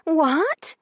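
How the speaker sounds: high, loud shocked shriek. A narrator's reading. Io shrieks at her brother's news. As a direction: shriek it in disbelief. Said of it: "she shrieked".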